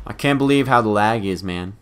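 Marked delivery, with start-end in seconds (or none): none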